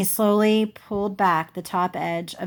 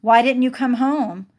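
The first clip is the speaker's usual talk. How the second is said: louder, higher, pleading demand; upset demand; 5 semitones higher, 6 dB louder than usual. Acted. Jessie demands why Grandma didn't come home, upset. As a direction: push for an answer.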